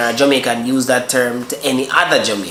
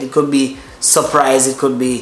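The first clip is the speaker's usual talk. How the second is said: ordinary delivery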